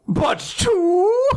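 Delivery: high, drawn-out disbelieving question; hammed-up rising shock; mock shock. Dad's shocked realisation, acted.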